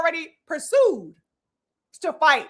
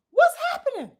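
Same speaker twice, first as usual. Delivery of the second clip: much higher but at normal volume, rising at the end; puzzled question; 8 semitones higher than usual. Real, not acted. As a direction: ask it again in genuine puzzlement.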